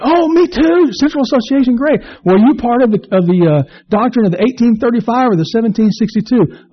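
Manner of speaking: bubbling over with agreement; passerby thrilled to keep agreeing; eager questions. Acted.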